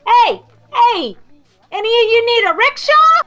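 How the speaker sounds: high, loud call from a distance; kid yelling. Acted. Rickshaw kid yells from a block away, high and loud. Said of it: "yells from a block away".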